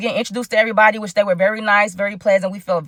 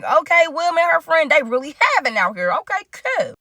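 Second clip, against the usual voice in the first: impressed; 7 semitones higher than usual. Retold or imitated. Impressed by grand house and butlers.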